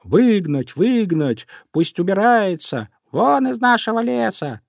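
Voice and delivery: high-pitched storybook clamour; rising cries, highest at the end; crowd shouting. A narrator's reading. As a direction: call out like a crowd demanding banishment. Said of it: "Все кричали одновременно".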